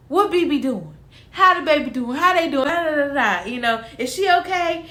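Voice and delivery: high, eager string of questions; imitated eager relatives. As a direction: play the excited in-laws checking in. Imitates his family checking on her.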